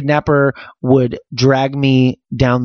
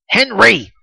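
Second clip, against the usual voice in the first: mock scolding; 8 semitones higher than usual. Shown as a demonstration. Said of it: "That's when I'm mad".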